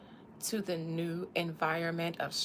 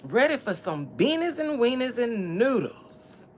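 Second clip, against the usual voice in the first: loud reminiscing; 4 semitones higher, 7 dB louder than usual. Pretend. Loud grandma-house memory.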